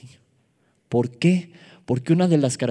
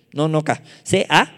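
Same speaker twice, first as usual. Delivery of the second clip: ordinary delivery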